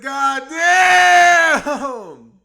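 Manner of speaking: shouted God damn, stretched out; loud exclamation. Real, not acted. Streamer yelling at chat, loud shout. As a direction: belt it out loud. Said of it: "I said".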